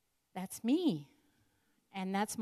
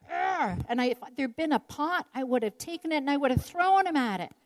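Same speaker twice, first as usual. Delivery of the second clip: heated retelling; 5 semitones higher, 8 dB louder than usual. Retold or imitated. Raising my voice.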